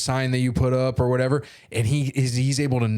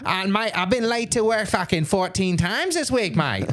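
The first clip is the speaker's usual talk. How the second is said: high-pitched, aggrieved Aussie tradesman; bad Australian accent; 8 semitones higher than usual. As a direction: complain in a hammed-up Australian accent. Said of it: "I'm bad at doing an Australian accent".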